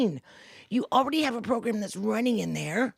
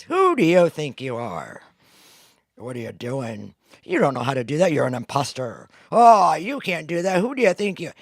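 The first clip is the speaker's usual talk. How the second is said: loud, lower scolding inner voice; harsh inner critic; 4 semitones lower, 7 dB louder than usual. Acted. The self-doubting voice mocking the listener, in a loud, lower scold. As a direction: sneer at yourself like a harsh critic. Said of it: "this voice that comes in, and it says".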